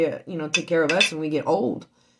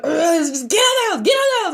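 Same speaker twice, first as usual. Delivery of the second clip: high shouting through tears; retold crying shout at husband; 12 semitones higher, 6 dB louder than usual. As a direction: yell it out, half sobbing. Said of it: "I told him, I was like".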